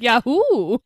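loud imitated whoop; Hammond's whoop. Podcaster repeating Hammond's funny line, loud whoop. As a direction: belt out a mock yahoo. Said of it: "He's like".

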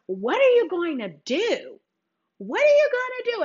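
the aunts demanding an answer, voice shooting up; pressing aunts. Retold or imitated. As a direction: play the worried aunts pushing her. Aunts pressing her, acted quote.

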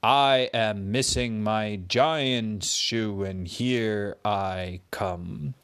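slow, booming giant chant. Acted. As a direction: stomp it out like a giant. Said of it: "And the kid giant said".

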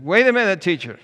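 high, loud cry to stop him; urgent call. A narrator's reading. Boy jumps up to stop the teacher, urgent high shout.

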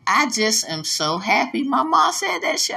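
ordinary talk

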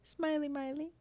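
high, teasing sing-song; playful high voice. Pretend. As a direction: tease it up high. Playful nickname.

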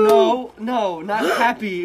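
loud high gasp, then loud protest; fake surprise gasp. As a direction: act startled at the door. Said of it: "a surprise thing".

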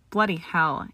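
ordinary delivery, nothing marked

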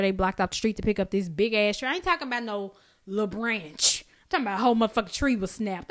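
ordinary talk

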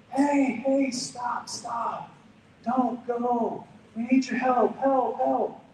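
high, loud frantic calling out; retold yell. Stranger calling for help across a parking lot, frantic high yell. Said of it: "I hear someone yell".

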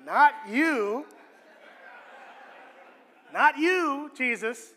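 loud, laughing aside; mock disciples, half-laughing. Preacher mimicking disciples, loud with a laugh. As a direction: brush it off with a chuckle. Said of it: "mocking disciples' attitude".

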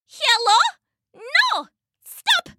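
very high, tiny mouse shouting. A narrator's reading. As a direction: squeak out a small, urgent shout. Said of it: "came a tiny shout".

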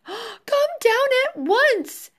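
gasp, then high, loud, slow order; alarmed scolding. A narrator's reading. Mother to sons flying too high, alarmed call. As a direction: call up to them in panic.

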